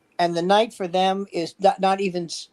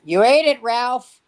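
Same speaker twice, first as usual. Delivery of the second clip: wife's voice; 6 semitones higher, 6 dB louder than usual. Retold or imitated. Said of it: "wife's reply in ad".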